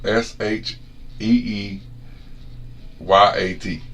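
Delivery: "S H E E Y A T" spelled slowly; spelled-out drawl. Shown as a demonstration. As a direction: spell out the stretched "Shit" letter by letter. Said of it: "It went from".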